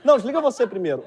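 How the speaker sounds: high, giggly lovestruck flirting; giggly late night call.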